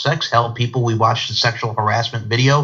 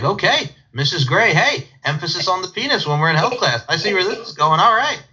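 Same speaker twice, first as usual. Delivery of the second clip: higher, teasing reaction to a teacher; mock intrigued; 7 semitones higher than usual. Pupil reacting to Missus Gray's emphasis, mock intrigued. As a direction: play a schoolboy catching on, voice lifted. Acted cheeky reply to teacher.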